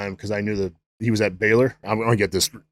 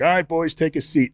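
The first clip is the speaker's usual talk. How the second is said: head coach's call; 8 semitones higher than usual. Retold or imitated. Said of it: "he's like".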